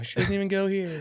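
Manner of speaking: high-pitched movie-line imitation; high mock call-out from a teen movie; Mean Girls quote. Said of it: "the Mean Girls reference".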